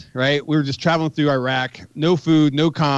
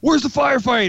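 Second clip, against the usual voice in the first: pitched-up urgent question; imitating urgent newcomer; 8 semitones higher than usual. Old man in flight suit running up, retold urgent high question. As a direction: ask as if running toward the action.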